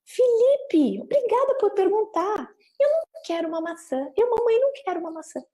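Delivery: very high, exaggerated child-directed politeness; literal-minded reply. Mother answers Felipe as if he really asked, exaggerated literal reply. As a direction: thank the child with big sing-song emphasis. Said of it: "responde literalmente como se fosse uma pergunta".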